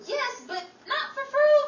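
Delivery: incredulous. Acted. Rachel objecting, incredulous.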